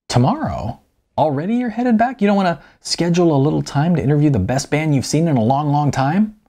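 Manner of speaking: high, surprised pressing; surprised protest. Acted. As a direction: jump on the news with disbelief.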